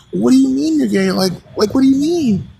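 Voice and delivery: hammed-up shocked mother; shocked mom.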